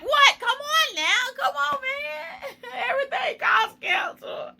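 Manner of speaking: high crying shout, slowed; shocked wail. Real, not acted. Hearing teeth work causes cancer, high shocked wail. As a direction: wail in disbelief. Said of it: "somebody said it caused cancer".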